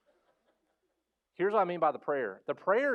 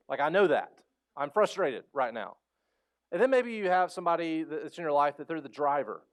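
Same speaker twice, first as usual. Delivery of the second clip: ordinary talk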